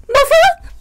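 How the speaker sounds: high excited call of Mother; surprised cry. Acted. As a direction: call out hoping it's her. Calling out to mother.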